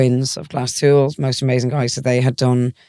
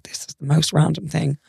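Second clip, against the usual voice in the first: whispered aside; 2 semitones higher than usual. Retold or imitated. Whispering at the airport.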